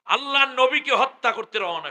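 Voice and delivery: high, loud sermon cry; fiery preaching. Acted. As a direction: preach this out at the top of the voice. Preaching at full force. (উচ্চস্বরে ওয়াজ)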